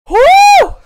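very high, loud woo; excited whoop. Real, not acted. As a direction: let out a piercing cheer. Celebrates the hype train.

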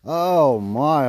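loud, awed exclamation; amazed. Real, not acted. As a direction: let the wonder burst out. Trapper sees how deep caught.

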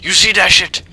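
loud, high hype; excited bragging. Real, not acted. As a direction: yell it out, pumped. Bragging after kill.

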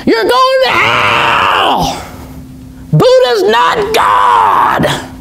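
high, drawn-out preaching yell; slow, piercing hellfire cry; street preacher shout. Retold or imitated. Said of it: "just started preaching".

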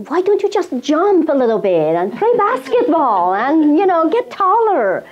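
high, mocking sing-song tease with a laugh; teasing relatives. Relatives mocking the shortest girl in the family, high teasing voice.

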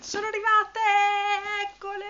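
high delighted exclamation; excited. Real, not acted. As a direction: squeal with joy at arrival. Package arrives, excited.

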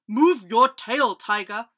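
loud, mildly grumpy order; grumbling bear. A narrator's reading. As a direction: grumble at a friend in the way. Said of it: "grumbled Bear".

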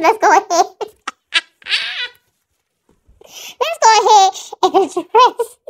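words breaking into laughter; trying to talk through laughs; laughing while talking. Real, not acted. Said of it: "I can't stop laughing".

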